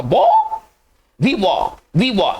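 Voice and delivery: बो drawn out, then वी वॉ; बो, वी वॉ in open syllables, endings silent; French pronunciation demo. Shown as a demonstration. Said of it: "देखिए क्या पढ़ेंगे".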